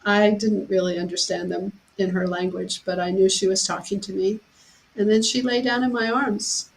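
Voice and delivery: ordinary talk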